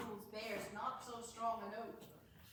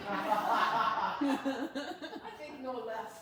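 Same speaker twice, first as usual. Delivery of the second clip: laughing; 10 dB louder, much slower than usual. Acted. Laughing while agreeing with ladies.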